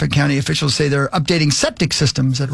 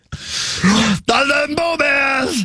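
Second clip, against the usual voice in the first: very slow, high drawn-out voice; super slow sleepy sloth; 10 semitones higher, much slower than usual. Acted. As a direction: drag the words out lazily, way up high. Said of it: "Crackhead Bob".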